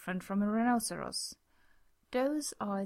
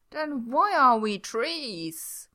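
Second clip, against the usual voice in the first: loud, slow panicked question; 3 semitones higher, 9 dB louder, slower than usual. A narrator's reading. Gwen panicking at Jack over the plan, loud and slow. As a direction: blurt it out in a panic. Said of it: "Gwen asked, panicked".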